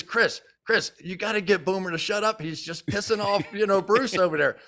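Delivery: high, pressing appeal; urgent plea. Retold or imitated. Lineman on the sideline pleading with Chris, high and urgent. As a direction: hurry the request up high. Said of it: "and he goes".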